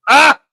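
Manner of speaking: high, loud yelp of surprise; shocked yelp. Real, not acted. Host yelping at his cohost's push-up jab, real surprise. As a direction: burst out with a sharp yelp.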